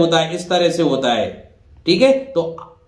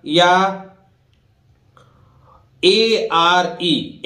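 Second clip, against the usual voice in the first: slowly spelling the letters; much slower than usual. Shown as a demonstration. Teacher slowly spells letters for students. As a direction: draw out each letter for the learners. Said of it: "देखो".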